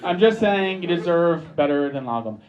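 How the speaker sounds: ordinary talk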